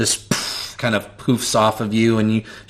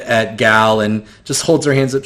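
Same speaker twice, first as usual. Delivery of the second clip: ordinary delivery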